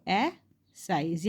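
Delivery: एसाइज drawn out slowly; a slow model reading of एसाइज; slow demo word. Shown as a demonstration. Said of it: "देखो".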